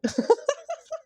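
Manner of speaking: high, breathless giggle of surprised joy; delighted laugh. Real, not acted. Reader laughing as the Lovers card appears, delighted giggle. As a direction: burst into a squeaky happy laugh.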